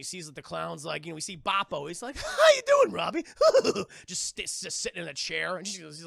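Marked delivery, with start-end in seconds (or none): 2.2-3.2 high, loud, cackling clown greeting; a sinister toy leering at the boy; creepy clown. Acted. Voicing creepy clown doll. 3.3-4.0 clown cackle. Acted. Clown laughs.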